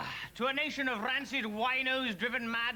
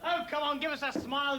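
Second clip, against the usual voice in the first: ordinary talk, nothing marked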